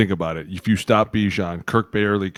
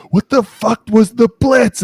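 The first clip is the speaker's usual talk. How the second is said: high, loud, slowed-down yell; irate coach; 14 semitones higher, 9 dB louder, slower than usual. Retold or imitated. Coach chews out a freshman over the blitz, irate yell. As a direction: yell it like an exasperated football coach. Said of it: "coach looks at me and he goes".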